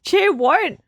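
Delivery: high, loud what; startled. Real, not acted. Streamer startled by the game, high, loud question. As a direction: jump on the word in disbelief.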